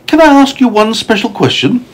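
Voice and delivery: put-on very high-pitched storybook boy; high, polite questioning character voice; curious young James. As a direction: ask eagerly as a little boy in a story. James asks the ladybird eagerly.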